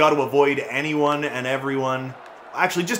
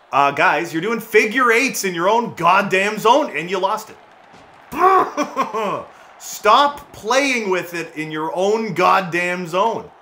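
exasperated scold; 6 semitones higher, 4 dB louder than usual. Real, not acted. Scolding teammates in own zone.

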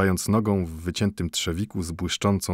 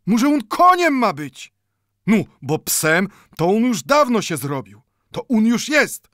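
Mendel much higher and louder, indignant; indignant outburst; 7 dB louder than usual. A narrator's reading. Mendel indignantly answers the watchmaker, much higher and louder. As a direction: hurl the questions back in outrage.

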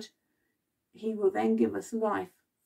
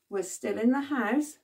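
usual speaking voice

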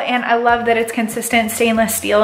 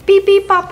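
higher and louder, chirpy beep noises; mock microwave beeps; 8 semitones higher, 5 dB louder than usual. Realtor demonstrating the microwave to viewers, with playful imitated beeps. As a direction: play the microwave's buttons with your voice.

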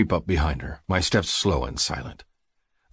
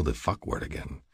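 ordinary delivery

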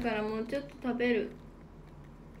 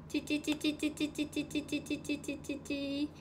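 tongue clicks; 4 dB quieter, slower than usual. Real, not acted. Calling the pet.

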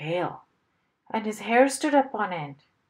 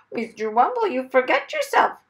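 loud, higher haughty parrot voice; 6 semitones higher, 7 dB louder than usual. A narrator's reading. Parrot putting Mister Wumble in his place, very haughty. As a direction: reprove him from a lofty perch. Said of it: "in a very haughty tone".

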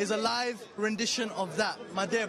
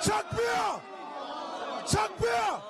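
call to the crowd; 8 semitones higher, much slower than usual. Real, not acted. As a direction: shout Takbir to rally everyone. Leading crowd chant call.

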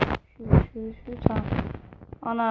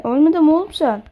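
disbelieving shout; 5 semitones higher, 9 dB louder, faster than usual. Real, not acted. Enemy survives hit.

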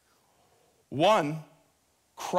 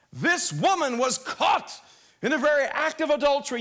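public accusation; 9 semitones higher, 6 dB louder than usual. Acted. Religious accusers bringing a woman to Jesus, public accusation. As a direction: call it out high, accusing her before everyone.

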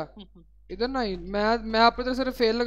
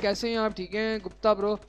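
ordinary delivery

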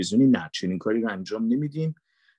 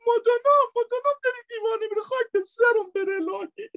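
panda wife voice. Acted. Panda wife behind glass calls her husband, high frantic woman's voice. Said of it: "هم صدای زنه رو گذاشتم".

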